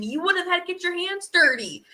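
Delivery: normal talking voice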